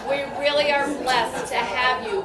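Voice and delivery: loud, laughing thanks; a burst of laughing gratitude; laughing gush. Real, not acted. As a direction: laugh through the praise.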